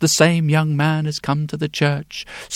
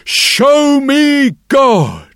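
slow, high, loud demand: Show me God!; loud cry; 10 semitones higher, 6 dB louder, much slower than usual. Acted. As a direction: cry out slowly, wild-eyed young man. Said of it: "he says in a loud voice".

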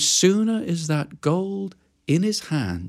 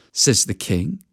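plain reading voice

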